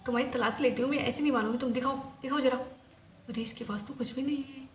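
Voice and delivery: muttering search. Acted. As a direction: grumble quietly while searching her.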